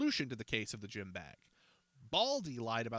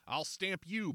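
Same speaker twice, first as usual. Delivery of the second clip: threatening; 6 semitones higher, slower than usual. A narrator's reading. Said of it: "Baldy threatened".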